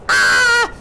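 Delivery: high, loud panicked scream; a sudden shriek of fright; frightened scream. Acted. Disciples scream as the storm rocks the boat, high scream. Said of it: "They screamed".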